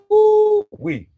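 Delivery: a very high, loud woo-wee; excited whoop. Real, not acted. Recalling being young, a high excited woo-wee. Exclamation wishing for youth.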